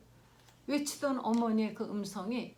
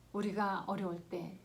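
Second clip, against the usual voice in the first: usual speaking voice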